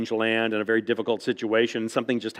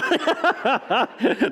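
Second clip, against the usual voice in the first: a high, bright burst of laughter; real laugh; 11 semitones higher than usual. Real, not acted. Amused author laughing at audience's question, high laugh.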